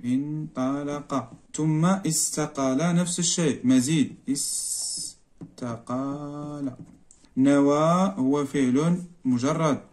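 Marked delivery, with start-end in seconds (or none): 0.0-1.3 انطلق drawn out softly in slow syllables; slow dictation. Shown as a demonstration. Dictating word while writing it. 5.5-6.7 استقال very soft, broken into long syllables; slow dictation. Shown as a demonstration. Dictating word while writing it.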